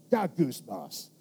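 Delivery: "Ga goose moss" low and muttered; grumbled gongysmos. Shown as a demonstration. Pastor showing the word sounds like complaining: muttered. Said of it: "It sounds like complaint".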